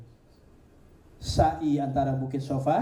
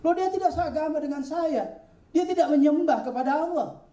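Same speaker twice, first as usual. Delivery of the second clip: much higher, rising to louder; indignant protest; 12 semitones higher, 3 dB louder than usual. Acted. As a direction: protest in disbelief. Ibrahim protests to Jibril.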